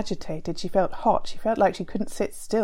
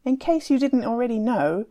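higher, louder sneering retort; mocking yell; 5 semitones higher, 4 dB louder than usual. Tufan yelling back at Timur in the brawl, mocking yell. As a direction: throw the insult right back at him. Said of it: "Tufan yelled back".

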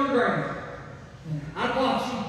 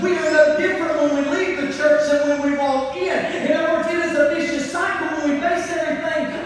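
raised, ringing preacher's cadence; full-force preaching; 4 semitones higher than usual. Acted. Pastor warns church about emotional faith, ringing sing-song preaching. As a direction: build the congregation up, half singing.

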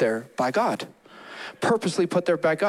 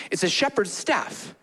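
ordinary talk, nothing marked

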